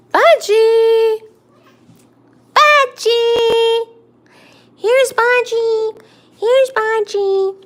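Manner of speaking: high, loud excited calls to the cat; calling the cat. Real, not acted. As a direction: sing out the cat's name, bright and loud.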